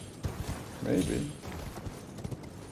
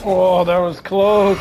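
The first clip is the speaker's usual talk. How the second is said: loud, high and drawn out; real burst of relief, much louder; loud relieved exclamation; 6 semitones higher, 11 dB louder, slower than usual. Real, not acted. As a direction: call out slowly after a narrow escape. Narrow escape during game fight.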